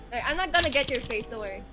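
ordinary talk

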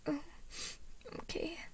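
put-on tiny, high, soft reluctant child; sniffly. A narrator's reading. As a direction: give in quietly like a tearful little boy. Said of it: "he sniffed".

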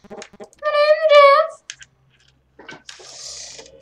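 high loud name is, then breathed Jeff; mock meme voice. Gamer repeats the Jeff meme in a mock voice. Meme line, second take.

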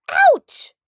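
very high loud yelp; yelp of pain. A narrator's reading. Doctor poked by cactus needles, sharp yelp. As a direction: yelp as the spines stab.

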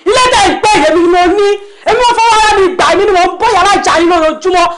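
high-pitched, heated outburst; angry tirade. Acted. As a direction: lay into them, voice pitched right up. Landlord's angry tirade at tenants.

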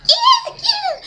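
high laughing squeal of joy; giddy laughing yeah yeah; hyped sister. Retold or imitated. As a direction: imitate a sister bursting with glee. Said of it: "she all hyped".

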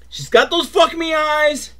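high-pitched gleeful exclamation; scandalized outburst. Real, not acted. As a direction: squeal it in mock scandal. Exclaims about the window's lust.

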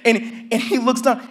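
usual speaking voice

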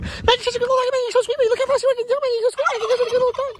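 put-on very high, squeaky doting baby voice; sweet baby talk. Shown as a demonstration. Showing how sweet talk makes Maggie wag, squeaky baby talk. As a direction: sweet-talk the dog so she wags her tail. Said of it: "So if I talk really sweet".

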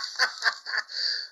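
breathy laugh. Real, not acted. Laughs after taunt.

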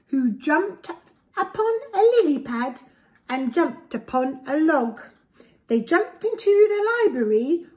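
lively, sing-song storybook read; reading to children. A narrator's reading. Parent reading a picture book to a child, lively storybook voice. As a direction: read it aloud to little ones with relish.

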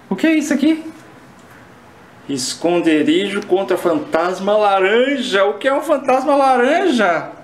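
high, loud panicked voice. Acted. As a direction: panic at the locked door. Panicked, door locked.

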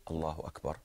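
soft, low, contained recitation; not shouting. Shown as a demonstration. Teacher contrasting with a shout for students, said softly. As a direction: say it low, just for those nearby. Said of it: "But if I say".

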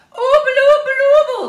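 very high, loud, slow frightened toffee cry. A narrator's reading. Moonface frightened, begging Jo not to go. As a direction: wail in fright through stuck teeth. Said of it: "cried Moonface in a fright".

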